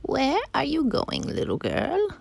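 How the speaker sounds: high, slow, swooping wolf voice; story wolf. Acted. Wolf questions the little girl he meets, high slow coaxing. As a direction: coax the child in a high singsong.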